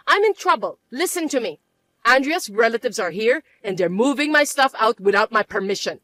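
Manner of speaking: ordinary talk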